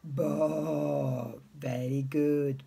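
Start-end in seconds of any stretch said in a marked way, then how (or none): 0.0-1.3 low, drawn-out sheep baa; long low baa, like a sheep; sheep bleat. Retold or imitated. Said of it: "what does the sheep say".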